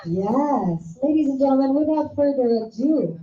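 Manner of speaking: big booming emcee call to the crowd; rally the audience like an event host; hype announcer. Acted. Host presents the queen to the audience, booming emcee.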